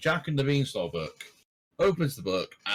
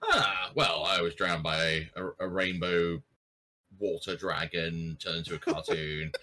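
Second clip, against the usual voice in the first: low, understated recounting; deadpan answer; 7 semitones lower than usual. Acted. Boy explaining his ordeal to Christopher Lloyd, low deadpan.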